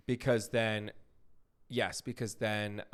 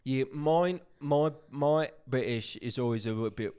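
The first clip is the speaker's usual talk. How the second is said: put-on Cockney British accent, louder; playful mock Cockney accent; 4 semitones higher, 5 dB louder than usual. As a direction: slip into a trashy Cockney voice. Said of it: "like, Cockney".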